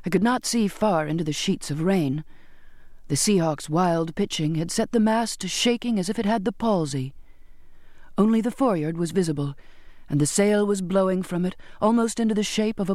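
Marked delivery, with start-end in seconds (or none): none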